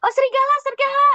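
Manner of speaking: desperate cry for help; real scream. Acted. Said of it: "benar-benar juga teriak".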